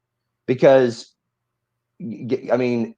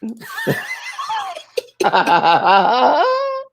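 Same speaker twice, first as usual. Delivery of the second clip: laughing hard; 14 semitones higher than usual. Real, not acted. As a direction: howl with laughter. Laughing hard after joke.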